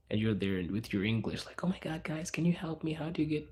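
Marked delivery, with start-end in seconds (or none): 1.6-3.5 higher, breathy-start plea for help; mock lost foreigner begging in the metro; a panicky visitor asking for directions; flustered tourist. Quoting lost English-speaking tourist.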